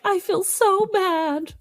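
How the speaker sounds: high, slow, mock-guilty whine. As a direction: act out the guilty shoplifter, drawn out and high. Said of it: "another one that was like".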